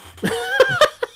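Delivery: shrill giggle burst; high squeal laugh. Real, not acted. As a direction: squeal with delight.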